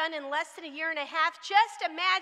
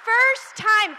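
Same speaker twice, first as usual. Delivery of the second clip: emphatic shout; 7 semitones higher, 13 dB louder than usual. Real, not acted. Crowd-rousing emphasis on first.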